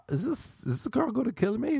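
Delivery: high-pitched mock panic; panicked thought. Man in bed fearing the girl, high mock panic. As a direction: worry aloud in a squeaky rising voice.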